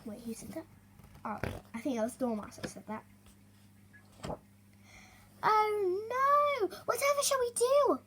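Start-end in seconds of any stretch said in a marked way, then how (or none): 5.4-8.0 high wailing cry. Acted. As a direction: wail in despair. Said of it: "wailed Vole".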